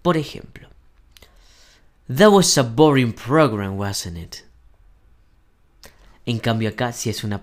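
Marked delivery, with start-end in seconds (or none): 2.1-4.4 slow model sentence, "wasn't it" falling as a statement; falling question tag. Shown as a demonstration. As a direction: voice goes down at the end on "wasn't it". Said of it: "the voice goes down at the end".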